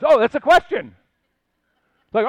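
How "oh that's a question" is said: realizing. Pretend. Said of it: "then you realize".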